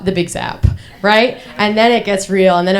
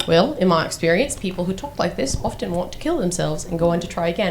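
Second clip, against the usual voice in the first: lower-pitched Australian doctor impression; imitated Australian accent; 4 semitones lower, 6 dB quieter than usual. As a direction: play the Australian doctor, low and measured. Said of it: "he was Australian".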